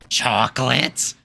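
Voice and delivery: slow questioning cartoon voice; cartoon voice attempt. Wishing to do SpongeBob characters, slow cartoonish question. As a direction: try a SpongeBob-style rasp. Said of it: "I wish I could talk like some SpongeBob characters".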